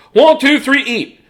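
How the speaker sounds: high, loud countdown shout; judge's yell. Acted. Judge yells to start the pie eating, high and loud. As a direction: call the start like a contest judge. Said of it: "he yelled".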